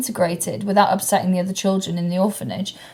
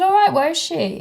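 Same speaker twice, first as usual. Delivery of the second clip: surprised question; 10 semitones higher than usual. Retold or imitated. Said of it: "I said".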